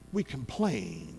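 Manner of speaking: quiet gloss after big line; sudden hush. Real, not acted. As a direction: drop right down to a hush. Drops to a hush.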